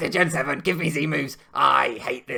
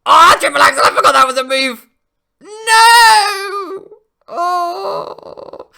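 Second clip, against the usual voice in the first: much higher and louder, crying out in disbelief; shocked anguished cry; 11 dB louder, slower than usual. Real, not acted. Player seeing the game lost to a forgotten move, anguished cry. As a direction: howl it at the screen in horror.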